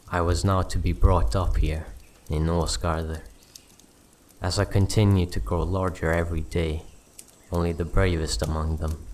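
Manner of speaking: ordinary delivery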